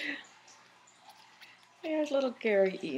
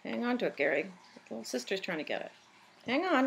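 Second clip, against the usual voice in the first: usual speaking voice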